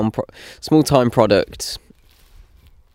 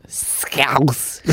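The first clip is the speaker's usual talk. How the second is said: the word Scouse in a hammed-up Scouse accent; Scouse accent try; 3 semitones higher, 5 dB quieter than usual. Shown as a demonstration. Trying out a Scouse accent on one word. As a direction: stretch "Scouse" Liverpool style. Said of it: "exaggerate the odd word in that accent".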